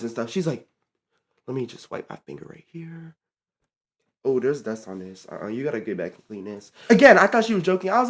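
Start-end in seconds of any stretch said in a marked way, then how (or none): 1.5-6.7 low, very soft mimicry of an inspection; picky inspector imitation. Coworker checking counters for dust, imitated low muttering.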